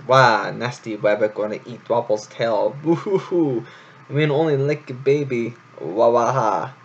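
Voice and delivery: put-on boo-hoo like a tearful toddler; crying baby mouse. A narrator's reading. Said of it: "set Dwapple crying in distress".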